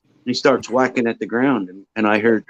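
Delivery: ordinary talk, nothing marked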